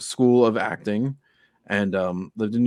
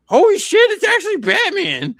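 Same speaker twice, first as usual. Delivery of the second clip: much higher and louder, gasping in shock; stunned disbelief; 7 dB louder than usual. Retold or imitated. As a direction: blurt it out like you can't believe your eyes. Firefighters stunned it's Batman.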